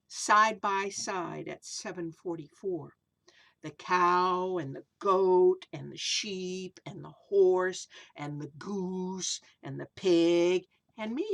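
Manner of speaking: sing-song rhyming read-aloud, slow in places; storybook verse reading; reading to children. A narrator's reading. Reads rhyming picture book aloud.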